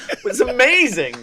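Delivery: high through laughter; can barely get it out for laughing. Real, not acted. Story punchline, laughing.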